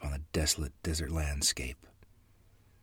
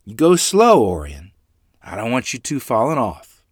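louder, higher motherly warning; cautioning mother; 5 semitones higher, 10 dB louder than usual. A narrator's reading. Mother warns her son not to fall off the horse, caution. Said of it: "cautioned us".

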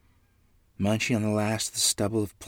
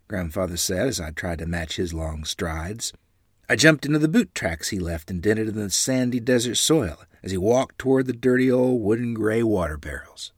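plain reading voice

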